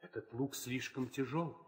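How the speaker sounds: timid plea. Acted. Suitor begging the king to ease the contest, timid soft plea. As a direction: beg the king almost under your breath.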